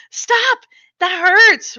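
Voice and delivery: high, loud cry of pain; pained yell. Acted. Little brother being hit by his sister, crying out in pain. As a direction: cry out as the blows land.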